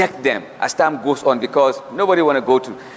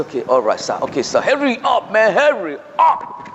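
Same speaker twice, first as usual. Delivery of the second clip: higher, anxious mock compliance; scared young inmate; 4 semitones higher than usual. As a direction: give in quickly and nervously. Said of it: "they say".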